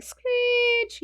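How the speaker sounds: long, very high held screech; imitated screech. Showing the sound of nails on blackboard, high screeching. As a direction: draw the word out like scraping nails. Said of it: "you know, that sound".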